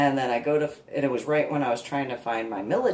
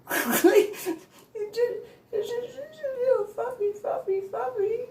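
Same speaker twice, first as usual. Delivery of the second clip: high, laughing fake sob; mock crying whine. To the handler, high mock-tearful whine. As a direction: pretend to cry for the puppy.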